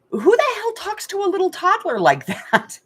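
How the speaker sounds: high, loud, outraged question with a laugh; indignant outburst. Real, not acted. Telling viewers about the father, high outraged disbelief. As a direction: let the disbelief burst out. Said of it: "indignant at the father".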